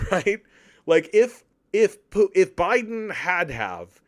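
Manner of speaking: usual speaking voice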